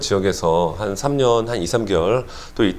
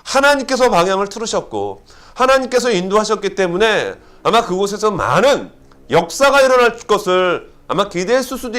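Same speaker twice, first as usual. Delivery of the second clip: fervent preaching; 7 semitones higher than usual. Acted. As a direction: preach it with rising fervor. Preaching at full force.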